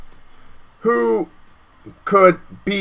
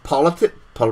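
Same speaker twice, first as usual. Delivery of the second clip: ordinary talk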